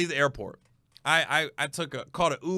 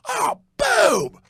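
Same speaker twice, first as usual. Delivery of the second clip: much higher, loud shouted boom; mock shout; 5 dB louder than usual. Drunk passenger punches the wall, shouting. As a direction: bellow it like a fist hitting the wall. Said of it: "He goes".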